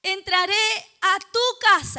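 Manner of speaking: very loud proclamation, punching "tu casa"; rousing shout. Real, not acted. Preacher trying to wake the congregation with the verse, rousing shout. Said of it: "Lo voy a decir de esa manera, a ver si se despierta".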